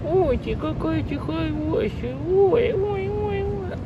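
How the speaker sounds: cooing to a cat. Real, not acted. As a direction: squeal sweetly at the kitty.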